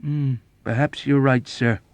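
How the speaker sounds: measured, placating concession; careful, deferential agreement; diplomatic agreement. A narrator's reading. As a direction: concede politely after a thoughtful hmm. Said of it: "Yossarian agreed diplomatically".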